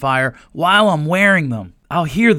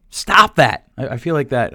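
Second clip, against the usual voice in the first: ordinary talk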